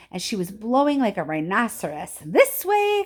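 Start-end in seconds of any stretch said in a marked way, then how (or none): 2.2-3.0 high, loud call. A narrator's reading. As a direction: call them onward. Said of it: "cried Mister Wonka".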